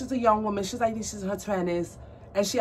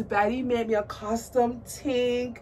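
usual speaking voice

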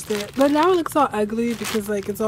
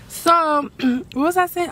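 usual speaking voice

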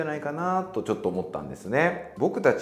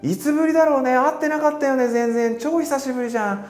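much higher and louder, gushing reunion chatter; excited catching up; 12 semitones higher, 4 dB louder than usual. Pretend. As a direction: pour out how much you missed them. Excited reunion chat across room. (久々の再会ではしゃぐ高い声)

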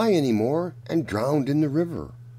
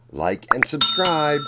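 emphatic sign-off; 4 semitones higher, 7 dB louder than usual. Real, not acted. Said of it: "And remember".

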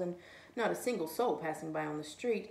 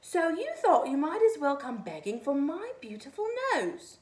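loud, higher furious roar; roaring snowman; 8 semitones higher, 10 dB louder than usual. A narrator's reading. Snowman roars at the begging rabbit, furious. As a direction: roar it out in wounded pride.

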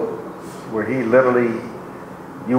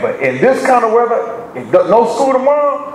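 preaching; 6 semitones higher than usual. Acted. Preacher urging the church not to complain, fervent preaching. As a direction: preach it up to the congregation.

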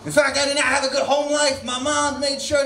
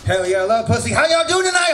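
ordinary talk, nothing marked

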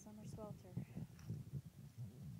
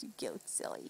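a soft whispered "gilly silly", much softer; teasing whisper; 12 dB quieter than usual. Real, not acted. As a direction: breathe it to her like a secret. Mom teasing daughter softly.

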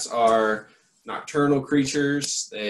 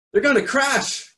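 much higher, alarmed gasp; 6 dB louder than usual. Acted. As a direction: gasp it out in fright. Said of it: "gasped Stellaluna".

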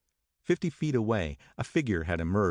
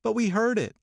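high, louder insistent Daisy; insist, high and eager; 4 dB louder than usual. A narrator's reading. Daisy insisting to Nick, high and loud. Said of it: "insisted Daisy".

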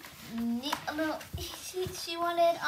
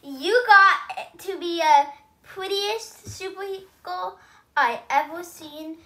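loud, slow praise from Mommy; 4 semitones higher, 11 dB louder than usual. Acted. Mommy praises her daughter.

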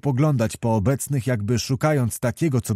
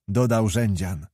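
ordinary delivery, nothing marked

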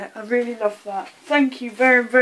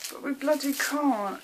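ordinary talk

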